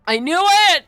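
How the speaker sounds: very loud, high exclamation; triumphant shout. Real, not acted. Streamer gloating at the drug reveal. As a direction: gloat at being right.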